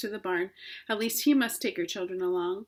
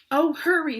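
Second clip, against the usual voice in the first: loud, high urgent plea; 6 semitones higher, 8 dB louder than usual. A narrator's reading. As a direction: hurry him along desperately. Wilbur urges rat.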